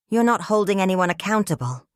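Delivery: plain reading voice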